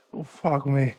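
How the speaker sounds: groaning through muscle pain. Real, not acted. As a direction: curse it out while aching. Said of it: "I'm not overreacting".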